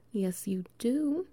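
ordinary delivery

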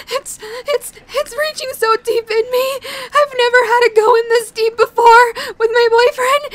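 ordinary talk

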